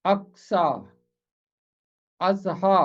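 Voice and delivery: अक्सा and अज़हा said singly, each ending in long "aa"; example pronunciations. Shown as a demonstration. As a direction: model अक्सा, then अज़हा, with a pause between. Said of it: "जैसे पढ़ा जाता है".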